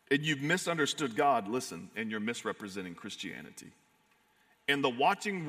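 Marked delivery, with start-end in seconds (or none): none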